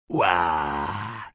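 tiefer, lauter Buh-Schrei; Erschreckruf. A narrator's reading. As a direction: jemanden mit langgezogenem Brüllen erschrecken. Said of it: "rief er". (laut und tief losbrüllend)

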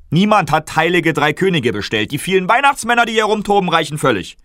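high, loud officious guard voice; guard ordering the kings to leave, loud and officious; stern guard. Acted. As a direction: order them out like a fussy guard.

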